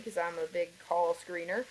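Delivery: ordinary delivery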